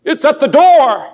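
high, ringing proclamation, loud; Old Testament prophet. Retold or imitated. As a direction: cry it out like a prophet's warning. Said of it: "felt like one of the Old Testament prophets".

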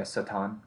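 Satan said as "suh-TAHN"; correct original pronunciation. Shown as a demonstration. Confirming for the co-host how it is said, Satan as "suh-TAHN". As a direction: stress the long "ah" ending of Satan. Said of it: "how do you say it correctly".

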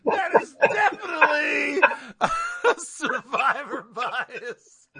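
words squeezed out through laughter; laughing. Real, not acted. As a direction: laugh while you say it.

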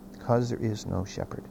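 low, soft and grave; hushed repeat. Real, not acted. Preacher repeating the verse to the congregation, hushed. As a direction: drop the voice right down to let it sink in.

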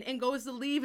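ordinary talk

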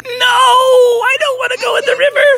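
very high, crying mock protest; panicked wail. Chloe the chameleon dreading the icy river, panicked wail. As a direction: wail it like a cartoon coward. Chameleon wailing about losing.

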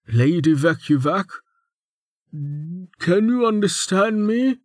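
high, slow, careful character voice; concerned questioning. A narrator's reading. Male servant checking whether the lady understands, concerned questioning. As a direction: draw the question out high for someone dazed. Man checking on dazed patient.

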